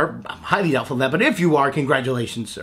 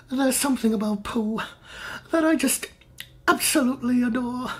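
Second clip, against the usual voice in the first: tender; 6 semitones higher, 5 dB quieter than usual. Real, not acted. Confessing love for Pooh.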